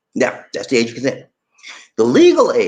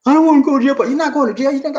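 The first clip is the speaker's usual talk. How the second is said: put-on high, loud protesting little boy; mock child wailing in protest; scared boy pleading; whiny kid; 12 semitones higher, 5 dB louder, faster than usual. Boy protests going to jail.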